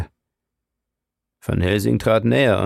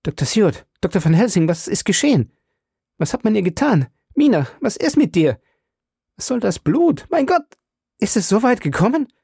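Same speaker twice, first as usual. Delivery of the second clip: frantic, much higher and louder questions; panicked; 11 semitones higher, 3 dB louder, faster than usual. A narrator's reading. Husband begging the doctors for answers, panicked and high. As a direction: fire the questions in mounting horror.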